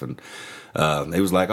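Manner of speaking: ordinary delivery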